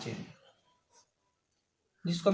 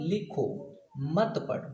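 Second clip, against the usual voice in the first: "लिखो", clear pause, then "मत पढ़ो" together; pause after लिखो; slower than usual. Shown as a demonstration. Teacher showing students a comma's effect, pausing after लिखो. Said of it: "यहाँ पे हमको थोड़ा रुकना है".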